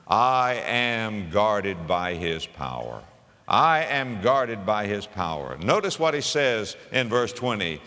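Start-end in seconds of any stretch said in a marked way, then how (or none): 0.1-5.6 slow, deliberate "I am guarded by his power"; slow affirmation. Shown as a demonstration. Preacher modelling what to say in a storm, slow and deliberate. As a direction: say each word slowly like a vow. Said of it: "you can say this".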